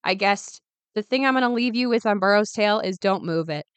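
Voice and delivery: ordinary talk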